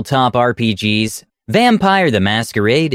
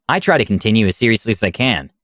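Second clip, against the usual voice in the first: ordinary delivery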